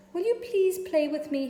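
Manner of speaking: high, loud pleading little voice; pleading pig voice. A narrator's reading. Lonely pig asking a lamb to play, high and pleading. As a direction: beg hopefully like a small pig. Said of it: "he asked".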